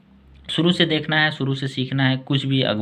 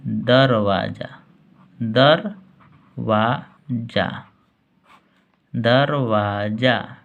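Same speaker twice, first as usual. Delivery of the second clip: दरवाज़ा drawn out very slowly, louder; slow repeated word; 3 dB louder, much slower than usual. Shown as a demonstration. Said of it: "sorry". (दरवाज़ा को ज़ोर से और धीरे-धीरे दोहराते हुए)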